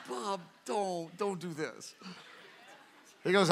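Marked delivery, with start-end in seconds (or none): 0.1-1.9 hushed, half-laughing protest; laughing plea. Retold or imitated. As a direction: quietly laugh out a don't. Pleading with Bob, laughing.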